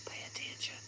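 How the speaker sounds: unvoiced hushed warning; whisper. Real, not acted. Whispering a warning to listeners during the session. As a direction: breathe it urgently without voice. Repeats the phrase, then whispers.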